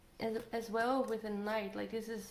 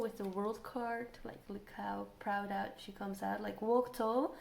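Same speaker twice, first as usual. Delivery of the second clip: usual speaking voice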